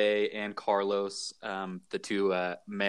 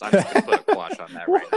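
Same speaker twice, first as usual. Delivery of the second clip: laughing; 8 dB louder, faster than usual. Real, not acted. Laughing while joking about the name.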